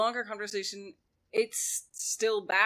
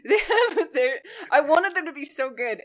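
real laugh through the words, pitched up and louder; 7 semitones higher, 10 dB louder than usual. Real, not acted. To a co-host about the Star Wars prequels, laughing. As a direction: laugh it out while complaining.

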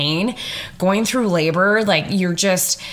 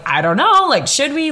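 pitched up, hesitant and unsure, a question trailing up; playing her usual indecisive self; dithering uncertainty; 7 semitones higher than usual. Wife imagining dithering about calling for help, mildly pitched-up unsure voice.

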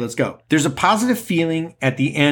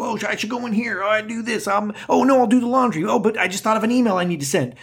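frantic dithering; 8 semitones higher, faster than usual. Retold or imitated. Frantic indecisive inner voice.

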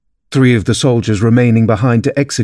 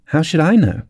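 higher-pitched cheeky comeback; pert, raised-pitch retort; bold retort; 6 semitones higher than usual. A narrator's reading. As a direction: answer back with sudden courage. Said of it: "surprised at her own courage".

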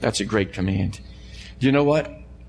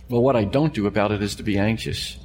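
normal talking voice

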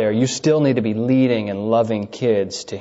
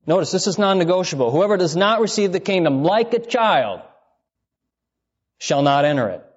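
emphatic preaching; 6 semitones higher than usual. Acted. Emphatic quote of Jesus.

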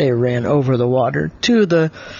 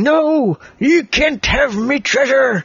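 high, drawn-out pirate protest; hammed-up pirate guarding his loot; 9 semitones higher, slower than usual. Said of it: "the pirate was saying".

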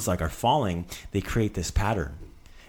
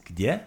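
gdje lifted into a rising question; question intonation. Shown as a demonstration. Teacher showing the question word, gdje rising at the end. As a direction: swing gdje upward as if asking. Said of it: "put that little accent so you're asking the question".

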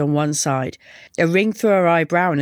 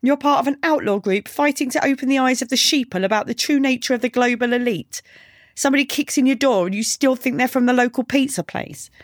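put-on higher-pitched, scornful girl character; mocking girl; 6 semitones higher than usual. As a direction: needle him in a raised female voice. Girl mocks Razor in story.

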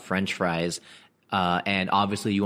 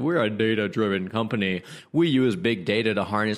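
lightly put-on corporate boasting; mock company spokesperson. Company bragging about being data-driven, lightly mocking voice. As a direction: lightly parody a self-satisfied company line. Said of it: "I'll always hear things like".

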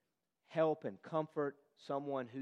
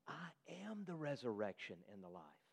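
hushed; 5 semitones lower, 12 dB quieter than usual. Acted. Jesus' words, spoken hushed.

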